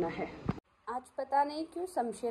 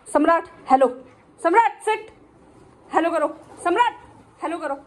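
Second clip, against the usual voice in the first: dog commands; 6 semitones higher, 9 dB louder than usual. Real, not acted.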